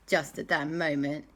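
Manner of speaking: ordinary delivery, nothing marked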